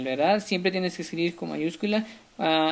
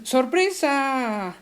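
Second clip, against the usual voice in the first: sorpresa stretched very slow, higher; exclaimed surprise; 5 semitones higher, much slower than usual. Shown as a demonstration. Teacher showing an exclamation, drawn-out surprise. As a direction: draw out ¡sorpresa! in wonder. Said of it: "por ejemplo".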